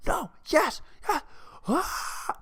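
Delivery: acting out a fan who drops the fight to watch the final shot; excited fan. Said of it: "one second you're like".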